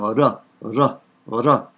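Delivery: R as one quick tongue click; short tapped r, repeated; European R. Shown as a demonstration. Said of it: "European kind of click".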